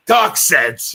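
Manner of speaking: loud straight-man reprimand; Abbott. Retold or imitated. Straight man pulling Costello back in, shouted. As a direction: shout it to rein in your partner. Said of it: "pull Costello back in and say".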